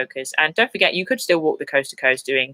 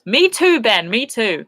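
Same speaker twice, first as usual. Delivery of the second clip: excited; 6 semitones higher, 4 dB louder, slower than usual. Real, not acted. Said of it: "I'm crying for the hills".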